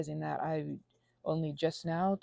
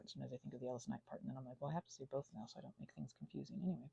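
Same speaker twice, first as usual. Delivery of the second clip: soft aside; 5 semitones lower, 14 dB quieter, much faster than usual. Real, not acted. Rambling aside.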